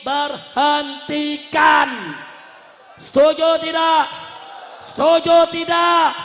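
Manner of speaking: slow demand swelling into drawn-out roar; rallying shout. Real, not acted. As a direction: bellow it to the crowd, stretching the key words. Demand put to rally crowd.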